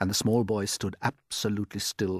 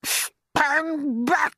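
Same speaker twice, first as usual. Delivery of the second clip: a far higher, louder aunt's shout; Aunt Spiker shouting; 7 dB louder than usual. Acted. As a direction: screech it out as the nasty aunt. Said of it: "Aunt Sponge shouted".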